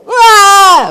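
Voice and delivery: a high mock wail of a crying boy; half-hearted imitation of a child bursting into tears; imitated child's wail. Son crying at bedtime prayer, mock wail. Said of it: "또 우는 거예요".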